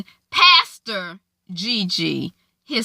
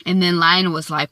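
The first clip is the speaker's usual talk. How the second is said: ordinary talk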